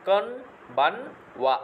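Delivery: কনবানওয়া drawn out syllable by syllable, kon-ban-wa; slow model word. Shown as a demonstration. Teacher slowly models কনবানওয়া for the students, drawn out syllable by syllable. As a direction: stretch কনবানওয়া slowly so each part is clear. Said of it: "শুভ Sandhya".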